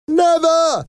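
very high, strained cry; defiant cry. A narrator's reading. Rebels answer from behind the barricades, crying out. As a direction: cry out defiance at the top of the range. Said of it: "cried a few weak voices".

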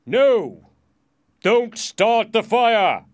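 frightened king's high, drawn-out shout. A narrator's reading. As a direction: shout to halt it at once. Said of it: "he shouted".

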